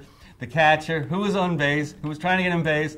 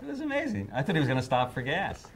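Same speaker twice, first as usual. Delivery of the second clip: usual speaking voice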